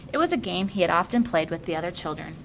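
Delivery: usual speaking voice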